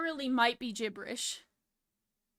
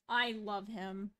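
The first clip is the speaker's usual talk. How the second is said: usual speaking voice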